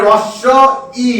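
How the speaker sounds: "রস্য ই" with silent h, r first and doubled s; correct pronunciation. Shown as a demonstration. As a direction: sound out "রস্য ই" in parts, pausing before ই. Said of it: "দন্ত সটা দুবার হবে উচ্চারণ". (হ বাদ দিয়ে, স দুবার করে "রস্য ই")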